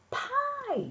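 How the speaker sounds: high, drawn-out cub's yell; Honey. A narrator's reading. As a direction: squeal the word high without much extra volume. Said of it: "yelled Honey".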